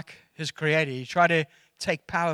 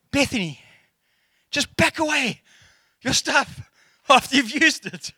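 raised, firm dad voice; a father's nagging reminder, pitched up; dad telling his daughter to tidy up, raised and firm; 7 semitones higher than usual. Retold or imitated.

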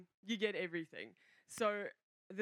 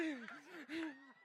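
higher-pitched laugh breaking off the sentence; 6 semitones higher, 3 dB quieter than usual. Real, not acted. Speaker cracks up describing the old couple, laugh. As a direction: break into a laugh mid-thought.

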